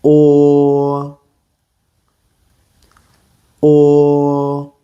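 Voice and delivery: "Or" with lips slightly rounded; "Or" rounded, like in English or; Mandarin o vowel. Shown as a demonstration. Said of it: "watch my mouth and repeat after me".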